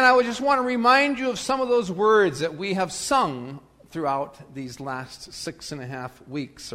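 usual speaking voice

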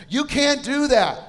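high, loud, drawn-out scolding; mock German yell. German ride attendant yelling at the parents, mock scolding yell. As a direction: imitate a German student yelling a ban. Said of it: "starts yelling at us with this German accent".

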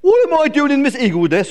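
high, loud indignant eagle; outraged echoed question; squawking. Acted. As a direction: squawk it back in disbelief. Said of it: "squawked Glen".

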